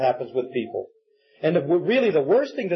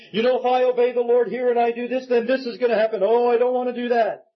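higher-pitched imitation of a hesitant objector; mock reluctance; 5 semitones higher than usual. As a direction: voice a worried excuse-maker, pitched up. Acting out a reluctant believer's objection.

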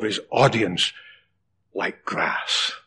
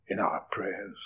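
sudden whisper to close the line; 6 semitones lower, 14 dB quieter than usual. Real, not acted. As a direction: drop to a hushed whisper. Preacher drops to whisper.

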